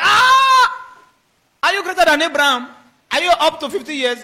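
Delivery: high, loud "Ah!" then indignant questions; shocked disbelief. Acted. Scoffers challenging Jesus about Abraham, loudly scandalised. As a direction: cry out in outraged astonishment.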